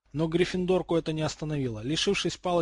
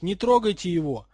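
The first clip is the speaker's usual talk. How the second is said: raised, louder urgent cry; startled shout; 4 semitones higher, 6 dB louder than usual. A narrator's reading. Harold defends the basilisk, startled shout. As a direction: call out in alarm to stop them. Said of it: "выкрикнул удивлённый Гарольд".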